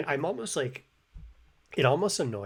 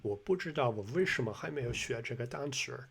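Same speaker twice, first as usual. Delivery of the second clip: attempted Beijing accent, V-like 为 and R-coloured endings; imitated Beijing accent. Imitating the man for viewers, Beijing accent. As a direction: have a go at a regional Beijing accent. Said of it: "I didn't do that very good".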